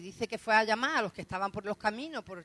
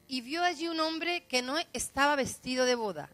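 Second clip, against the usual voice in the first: slow, raised scripture reading; lectura bíblica; 4 semitones higher, 6 dB louder than usual. A narrator's reading. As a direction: proclaim the verse, higher and slower. Reads the verse aloud. (lectura solemne, lenta y más aguda)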